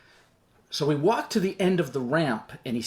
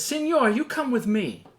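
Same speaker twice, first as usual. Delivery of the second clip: higher, lightly put-on broken English command; 8 semitones higher than usual. Soldier repeats order to hesitant passenger, in broken English. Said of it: "he talks in broken English".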